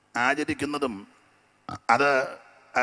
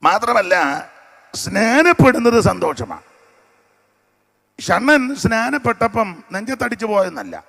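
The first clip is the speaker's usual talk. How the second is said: loud, high preaching; 9 semitones higher, 11 dB louder than usual. Acted. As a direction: drive the point home. Preacher raises his voice. (ഉച്ചത്തിലുള്ള പ്രസംഗം)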